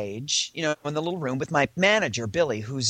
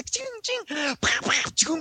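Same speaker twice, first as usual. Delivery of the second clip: high, popping camera noises; imitated flashbulbs; 12 semitones higher, slower than usual. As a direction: mimic a volley of flashbulbs. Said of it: "flash bulbs and scri".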